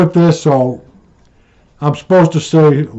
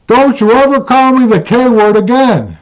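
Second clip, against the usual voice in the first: higher, louder indignant warning; retold angry repeat; 9 semitones higher, 4 dB louder than usual. Wife repeats her warning, higher and louder. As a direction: jab the word ever.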